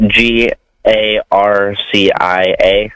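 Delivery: slow letter-by-letter spelling. Shown as a demonstration. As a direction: spell out each letter slowly. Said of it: "my name is [name]".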